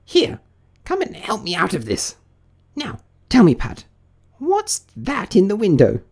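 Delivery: high, bossy rabbit voice; irritated rabbit. Acted. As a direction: order the servant about crossly, high up. Angry Rabbit orders Pat about.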